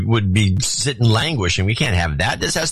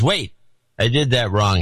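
normal talking voice

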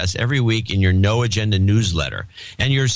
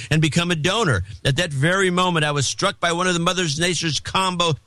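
higher, building storyteller voice; dramatic story reading; 7 semitones higher than usual. Acted. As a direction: read it like a suspense tale. Dramatic reading of listener letter.

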